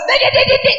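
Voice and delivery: high, loud rapid dek dek; shrill-free mock nagging burst; mock nagging chatter. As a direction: squeal it out like a nagging wife. Imitating wife's nagging sound.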